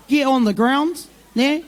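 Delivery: ordinary talk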